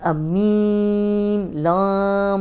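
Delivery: मीम and लाम pushed through the nose, nasal twang; wrong nasal way. Shown as a demonstration. Teacher showing the mistake, nasal मीम and लाम. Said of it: "तो नाक में आवाज़ जा रही है, ऐसे नहीं पढ़ना".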